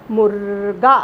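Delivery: मुर्गा with a clear र sound; careful pronunciation. Shown as a demonstration. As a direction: make the र in मुर्गा heard. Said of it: "इसका उच्चारण हम कैसे करेंगे". (मुर्गा में र की आवाज़ साफ़)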